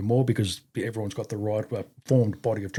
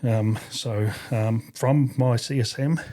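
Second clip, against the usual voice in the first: ordinary delivery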